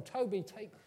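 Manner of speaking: ordinary talk, nothing marked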